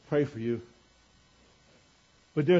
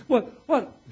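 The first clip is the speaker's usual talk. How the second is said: put-on confused teen; 8 semitones higher, 6 dB louder than usual. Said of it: "You know".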